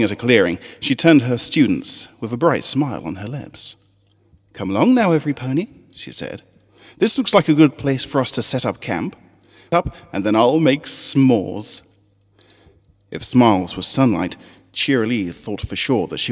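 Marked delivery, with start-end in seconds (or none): 4.5-5.7 bright, higher schoolteacher calling her class; rally the little ponies with a smile; cheerful teacher voice. Acted. Teacher Cheerilee calling her students to camp, bright and high. 7.0-11.8 bright, higher schoolteacher calling her class; rally the little ponies with a smile; cheerful teacher voice. Acted. Teacher Cheerilee calling her students to camp, bright and high.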